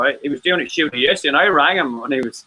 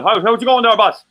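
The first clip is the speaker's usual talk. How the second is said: put-on higher, louder Irish traveller accent greeting; 6 semitones higher, 5 dB louder than usual. Man imitating rappers from Cork, Irish traveller accent. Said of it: "they sound like travelers".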